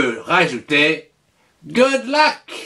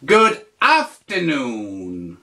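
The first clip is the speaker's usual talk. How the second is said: slow, careful teacher model; slow model phrase; 4 semitones lower, slower than usual. Shown as a demonstration. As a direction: spell it out slowly. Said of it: "tu dis".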